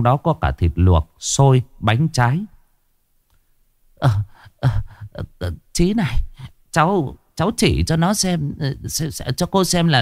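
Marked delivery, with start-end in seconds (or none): 4.0-10.0 put-on high, faltering older woman's voice, stumbling over words; an anxious mother fumbling to ask; mother asks Chí Béo, halting and nervous; hesitant old woman.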